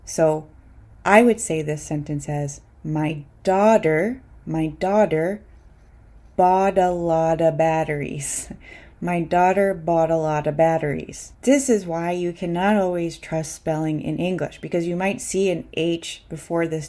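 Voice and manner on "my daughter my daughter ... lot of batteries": every T in "daughter bought a lot of batteries" a tapped D; fast Ds for Ts. Shown as a demonstration. Teacher models the practice sentence, each T a fast D. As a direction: link "bought a lot of" with quick Ds. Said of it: "would be pronounced as fast Ds".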